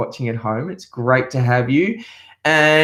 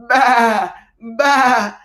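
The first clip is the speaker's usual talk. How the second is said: high, louder baa; high bleating sheep for kids; 9 semitones higher, 3 dB louder than usual. Pretend. Sheep sound in children's song.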